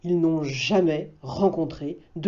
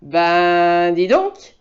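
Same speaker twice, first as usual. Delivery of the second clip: bear exclaiming; 6 dB louder, much slower than usual. Acted. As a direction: gape at the wolf. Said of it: "dit un ours".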